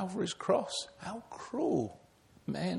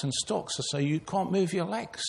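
ordinary talk

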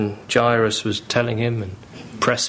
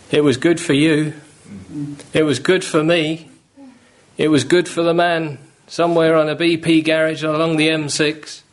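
insistent preaching; 5 semitones higher than usual. Real, not acted. Preacher to congregation, fervent insistence. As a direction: drive home it was good. Preaching builds to full force.

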